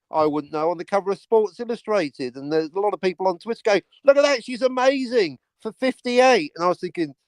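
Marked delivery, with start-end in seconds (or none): 4.0-6.5 high-pitched mock amazement; mock gushing. Imitating people on Twitter raving about a star, mock gushing. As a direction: gush like an easily impressed fan.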